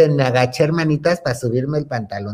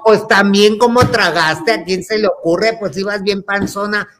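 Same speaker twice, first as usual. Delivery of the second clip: retold scolding; 6 semitones higher, 4 dB louder than usual. Acted scolding over overeating.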